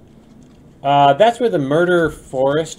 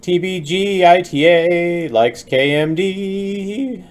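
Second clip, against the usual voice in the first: slow grand announcement; 7 semitones higher, 4 dB louder, much slower than usual. Pretend.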